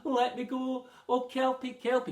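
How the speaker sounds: loud, high desperate begging; pleading. A narrator's reading. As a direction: beg for your life on your knees. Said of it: "He pleaded".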